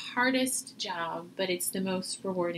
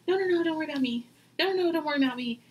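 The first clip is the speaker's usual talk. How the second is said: quick, higher brushing-off "no, no, no"; mock self-dismissal; 7 semitones higher, 3 dB louder, much faster than usual. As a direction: wave off concern, fast and bright. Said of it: "So if you're always".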